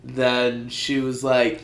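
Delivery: ordinary talk, nothing marked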